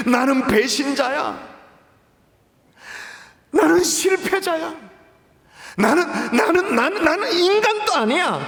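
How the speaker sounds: a hint of breaking down; despairing self-reproach. Preacher imitating Peter berating himself, anguished. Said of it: "여러 날을 통곡하면서".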